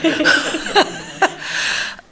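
high, airy giggle; delighted laugh after a cheer. Real, not acted. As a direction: burst into a high breathy laugh. Laughs after her own yay.

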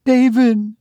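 high cry of the name; sobbing. A narrator's reading. Mother greeting son, mildly sobbing. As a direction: exclaim the name, pitched very high. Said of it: "she sobbed".

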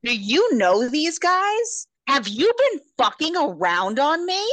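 loud, high, indignant questioning; jealous, outraged interrogation; acted accusation. Jealous boyfriend accuses her.

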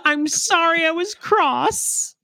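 high, drawn-out imitation of a child; mock child voice. Mocking a child's old-fashioned apology, high drawn-out put-on voice. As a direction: push it way up high and slow, hammed-up. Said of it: "that a child would say".

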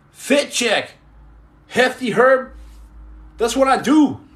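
high, loud, punchy catchphrase delivery; playful catchphrases. Pretend. Streamer shows off his catchphrases to viewers, high and playful. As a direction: call out each phrase high, with a pause after it.

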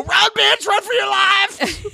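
high shrill scream, slowed at the end; frantic scream. Retold or imitated. Warning the ladies away from Rob Roberto, frantic high scream. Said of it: "I go".

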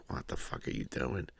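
whispered, incredulous; baffled whisper. Retold or imitated. Mocking the third Creed film, a stunned whisper. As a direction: hiss it in disbelief under the breath. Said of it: "Creed three was just like".

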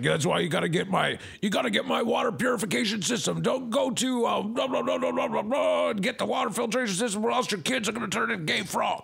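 high, fast ranting salesman voice; Alex Jones impression. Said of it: "he's like".

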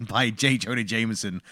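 ordinary talk, nothing marked

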